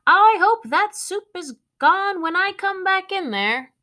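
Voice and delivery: high, loud call from another room; calling mom. Acted. Mom calling from the next room about the soup.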